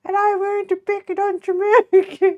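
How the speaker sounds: high, put-on cutesy girl voice; attempted VTuber voice. Streamer failing to copy cute VTuber girls, high put-on voice. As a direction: introduce yourself in a badly faked squeaky cute voice. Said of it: "I don't know how to make the voice the cute, uh, VTuber girls make".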